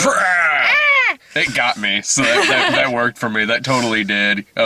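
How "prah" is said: sudden high burst imitating a scare; jump scare noise. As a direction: blurt out a startling monster noise.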